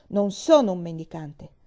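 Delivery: put-on boy asserting his dignity, with a touch of pride; proud. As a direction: repeat it with a sudden flash of pride. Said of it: "ripeté con un'improvvisa punta di orgoglio".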